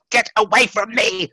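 high screeching cry. Acted. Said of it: "screeched the darkness".

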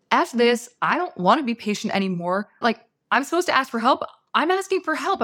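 fed up, demanding help now; angry outburst. Retold or imitated. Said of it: "I'm, like, screaming in my head".

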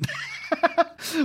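short burst of amused laughter. Real, not acted. Host cracking up at the comic's exploding head, amused laugh.

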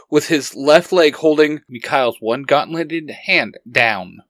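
ordinary delivery

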